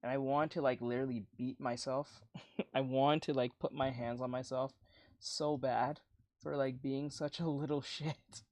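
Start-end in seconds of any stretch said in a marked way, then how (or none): none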